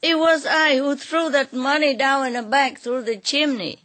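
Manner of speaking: high, loud, pleading cry; crying plea. Acted. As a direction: beg through tears, voice pitched high. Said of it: "but crying".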